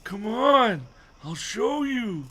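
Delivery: high, slow, drawn-out character voice; high-pitched, hammed-up old man; Mister Dawes. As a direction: coax in a high put-on voice. Mister Dawes in his put-on voice.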